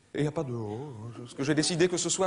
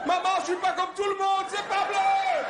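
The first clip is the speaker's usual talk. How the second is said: put-on little girl; 13 semitones higher than usual. Little girl crying out to her mum in a high mock voice. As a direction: squeal it like a panicked kid. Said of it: "Elle dit".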